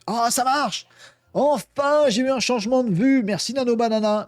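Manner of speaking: high-pitched, real burst of triumph; delighted relief. Real, not acted. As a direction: cheer excitedly as it finally works. Prompt finally works after failed tries. (cri de joie soulagé, voix qui monte)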